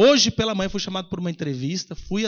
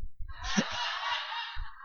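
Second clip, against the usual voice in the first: wheezy, breath-only laughter; long airy chuckle; amused laugh; 10 dB quieter than usual. Real, not acted. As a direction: laugh helplessly without voice. Laughs at the coincidence.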